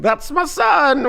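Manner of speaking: high, slow, laughing proud line; proud dad, laughing. Retold or imitated. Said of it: "he was like".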